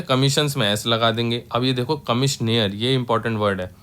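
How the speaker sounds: ordinary delivery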